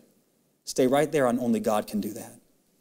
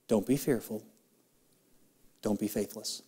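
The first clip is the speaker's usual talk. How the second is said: gentle quote; 6 semitones lower, 8 dB quieter than usual. Acted. Quoting Jesus gently.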